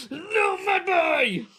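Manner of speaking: very high, slow, stretched squeal; playful squeal at his son. Real, not acted. Playing with excited baby.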